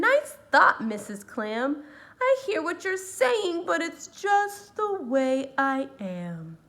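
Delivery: loud, put-upon fish voice; fish replying. Acted. The fish answers his friend the clam, sulky and loud.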